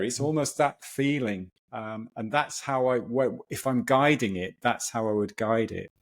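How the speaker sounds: ordinary talk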